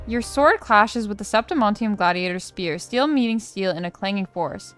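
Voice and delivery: dramatic read-aloud, stresses lifted on key words; story narration. Acted. Reads quest story text aloud.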